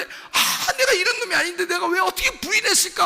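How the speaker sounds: very high, loud anguished wail; tormented outcry. Acted. Preacher voicing Peter after denying his Lord, tormented outcry. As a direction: wail in agony at your own failing. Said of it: "막 괴로워하다가".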